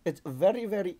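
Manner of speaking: half-formed put-on Indian accent. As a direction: try out an Indian accent, haltingly. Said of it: "I need to get into my accent".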